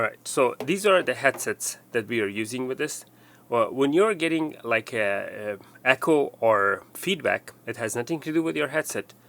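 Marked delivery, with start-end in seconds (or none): none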